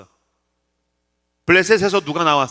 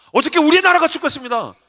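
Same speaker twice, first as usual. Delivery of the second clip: high-pitched mock fervor; insistent fan; 8 semitones higher, 4 dB louder than usual. Young soccer fan insisting to his pastor, mock fervor. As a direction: imitate an ardent young man announcing big news.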